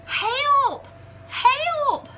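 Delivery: very high, loud cries for help; a big bear yelling in fright; frightened bellow. Acted. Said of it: "bellowed Bear".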